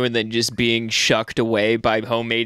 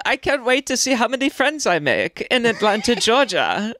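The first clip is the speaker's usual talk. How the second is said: high-pitched mock Swedish accent; eager exchange student with put-on Swedish accent; Swedish kid accent; 10 semitones higher than usual. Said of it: "blue-eyed Swedish".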